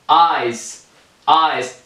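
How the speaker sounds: "آیس" opened on aa gliding to ee; teacher's demo of "آیس" starting on open aa. Shown as a demonstration.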